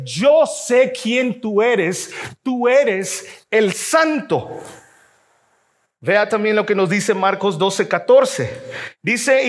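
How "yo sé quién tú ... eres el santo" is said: very slow, each word weighed; slow, solemn demon's admission; demon's words, slowly. Acted. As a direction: drag out the demon's confession. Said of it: "El demonio le dice".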